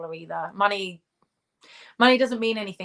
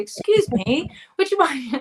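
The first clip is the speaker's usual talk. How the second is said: louder, higher, played-up English accent; 7 semitones higher, 6 dB louder than usual. Shown as a demonstration. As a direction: lay the English accent on thick, pitched up. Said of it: "just talk in an English accent".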